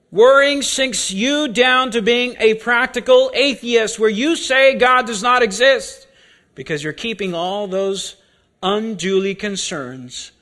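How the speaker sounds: usual speaking voice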